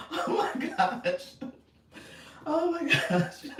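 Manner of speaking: high exclamation breaking into real laughter; laughing to tears. Real, not acted. Host recovering from her Spanish blunder, laughing to tears. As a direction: exclaim it while still cracking up. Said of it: "I'm just literally crying".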